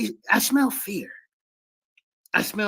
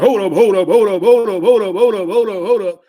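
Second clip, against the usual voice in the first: louder, insistent repeated hold ups; Garfin's loud hold up; 11 dB louder than usual. Retold or imitated. Debater mocking a rival's interruptions, loud insistent hold ups. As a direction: hammer each hold up like a rival cutting in. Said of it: "Garfin's gonna be like".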